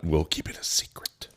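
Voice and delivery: soft conspiratorial whisper. Real, not acted. Teasing listeners about the hammer challenge, whispered. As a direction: whisper it like a secret.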